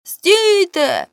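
high, urgent cry; shouted from the well. A narrator's reading. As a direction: call up to the men above. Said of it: "крикнув він з колодязя".

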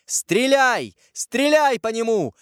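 high-pitched commanding cry; shouted order. A narrator's reading. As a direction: order the guards to fire, crying out. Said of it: "крикнула Екатерина". (крикнуть приказ)

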